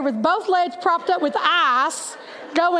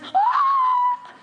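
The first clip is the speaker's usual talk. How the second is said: high startled yelp; a sudden high cry as she trips; yelp of falling. Retold or imitated. Teacher tripping in the car line, high yelp.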